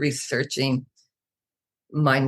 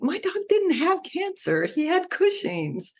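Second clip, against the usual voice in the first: high-pitched, indignant retelling of her answer; mock exasperated retort. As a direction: throw it back at the vet in disbelief. Retold argument with the vet.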